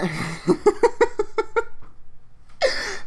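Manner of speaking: squealing high laugh; a real high-pitched laugh fit; high burst of laughter. Real, not acted. Laughing at his own fan fiction plan, high squealing laugh.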